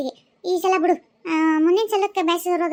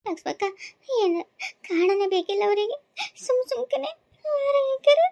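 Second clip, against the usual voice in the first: tearful, sobbing complaint, higher voice; crying girl; 7 semitones higher, slower than usual. Acted. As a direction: sob it out like a young wife locked out.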